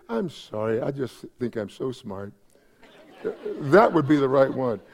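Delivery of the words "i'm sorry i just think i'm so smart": low, soft mock confession; subdued, sheepish send-up; mock apology. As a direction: apologize meekly for being clever. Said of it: "I wish they'd say".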